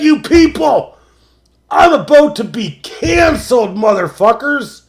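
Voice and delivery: high, loud ranting shout; Don Cherry-style rant. Acted. Host channels Don Cherry on air, high loud shout. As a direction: bellow it like a hockey rant. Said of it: "I just Don Cherry'd it and said".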